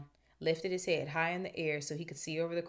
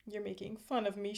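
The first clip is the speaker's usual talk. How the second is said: higher, put-on accusing voice; wounded Myrtle; 4 semitones higher than usual. A narrator's reading. Myrtle suspecting Hermione of mockery, wounded.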